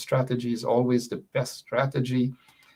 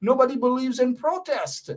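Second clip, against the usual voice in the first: high-pitched, fired-up rant; worked up; 11 semitones higher than usual. Real, not acted. Frustrated host rants to viewers about the opposition, highly worked up. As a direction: let the frustration push the voice up. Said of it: "you can tell how I'm feeling, how emotional I am".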